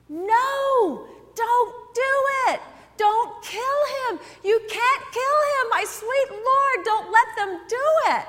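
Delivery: high, loud, slow anguished crying out; sobbing cry. Retold or imitated. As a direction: plead through tears not to kill him. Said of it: "standing up and sobbing, and she is crying out".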